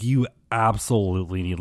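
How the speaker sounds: ordinary delivery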